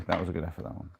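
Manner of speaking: soft, murmured "That was a good effort"; quiet repeat. Real, not acted. Repeating it to himself after the miss, softly. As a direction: let it drop to a quiet aside.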